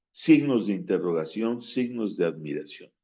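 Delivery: ordinary talk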